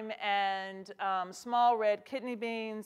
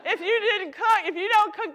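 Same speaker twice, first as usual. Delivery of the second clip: much louder and higher, like preaching good news; an emphatic, real call, louder and higher; excited rallying cry; 10 semitones higher, 8 dB louder than usual. Real, not acted. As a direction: lift the room with hope. Rallying non-cooks.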